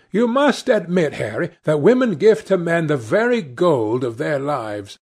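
insistent interruption. A narrator's reading. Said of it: "interrupted Dorian".

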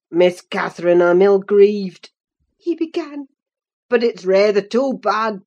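low grudging apology; gruff Earnshaw. A narrator's reading. Earnshaw stops her on road.

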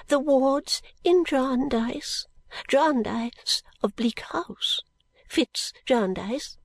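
slow, high, half-whispered formal introduction; stately. Acted. Miss Flite introduces the wards, slow and stately. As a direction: introduce them with great ceremony. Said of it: "with great stateliness".

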